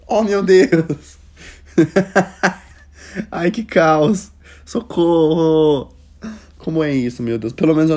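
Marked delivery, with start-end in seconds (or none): 0.1-5.9 loud, slow, high cries of shock; loud drawn-out shocked yelling with laughter; shocked outburst. Real, not acted. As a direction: scream in mock horror, drawn out. Reacting to cousins story.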